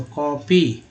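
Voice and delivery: slow, drawn-out copy; sounded out. Shown as a demonstration. Teacher showing learners a common word, slowly sounded out. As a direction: stretch copy syllable by syllable.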